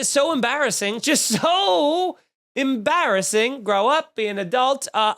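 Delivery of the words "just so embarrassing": "so" stretched long and higher; drawn-out "so". Real, not acted. Host mocks Disney-themed weddings, "so" heavily drawn out. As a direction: hold "so" out in exasperation, then land "embarrassing".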